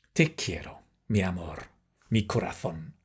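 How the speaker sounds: soft, low pretend love murmur; playful tender endearment. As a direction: coo it quietly, as if to a sweetheart. Mock love words to coffee.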